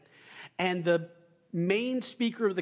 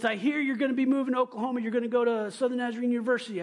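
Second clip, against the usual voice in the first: quick, higher booming mock yell; fast phone yell; 8 semitones higher, 3 dB louder, much faster than usual. Mister Ricky on the phone recruiting the speaker, fast mock yell. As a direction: rattle it off like a loud pastor on the phone. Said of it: "he kinda yells when he's on the phone".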